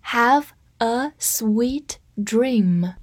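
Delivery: very slow, each word stretched; slow read. Shown as a demonstration. Teacher reads slowly for class to repeat. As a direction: stretch it out for learners. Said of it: "跟我慢读一遍".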